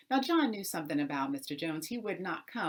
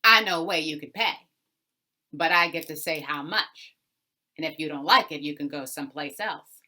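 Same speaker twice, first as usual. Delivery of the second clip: a louder, hard-bargaining Mister Jones; gruff landowner voice; 10 dB louder than usual. Acted. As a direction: lean over him, driving a bargain, louder. Mister Jones sets the terms.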